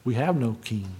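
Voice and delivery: low, hushed repeat, broken by a pause; quiet, grave quote. Retold or imitated. Preacher repeats the priests' words to let them sink in, quietly. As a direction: let it fall away softly.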